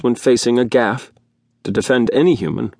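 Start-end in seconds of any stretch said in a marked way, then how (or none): none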